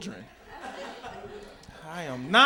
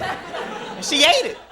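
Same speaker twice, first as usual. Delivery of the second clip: ordinary delivery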